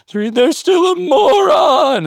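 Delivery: sudden high, loud snapping voice; calm counting giving way to a comic yell; hammed-up loss of temper; mock outburst. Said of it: "you could work on breathing techniques".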